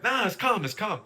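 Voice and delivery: high, curt mock "it's calm"; mock Simba fuming. Podcaster imitating Simba claiming calm as he storms off, mock fuming.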